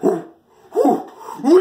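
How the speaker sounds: high, loud gagging whoos; mock panicked whooing. Owner fleeing the farting dog, whooing. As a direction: gasp out whoos at the stink. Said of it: "and I was like".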